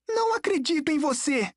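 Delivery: put-on high, strained boy's outburst; distressed, high-pitched refusal; angry disbelief. A narrator's reading. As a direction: throw it back in a high voice. Nico rejects him in panic.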